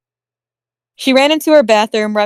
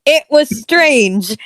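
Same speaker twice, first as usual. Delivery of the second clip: slow, raised narration over interruptions; higher, drawn-out, deliberate restart; insistent restart; 5 semitones higher, much slower than usual. Real, not acted. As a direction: reclaim the floor, stretching each word. Narrator restarts loudly after interruptions.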